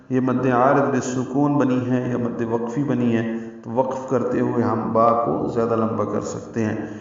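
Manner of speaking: usual speaking voice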